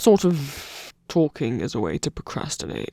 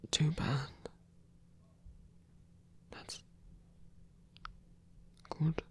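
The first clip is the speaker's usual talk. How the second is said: whisper; 12 dB quieter, slower than usual. Acted. Accepting touch.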